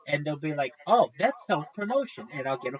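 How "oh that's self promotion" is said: higher, louder sneering "Oh, that's self-promotion"; mocking imitation. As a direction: parody the smug mod. Mocking the moderator's reply.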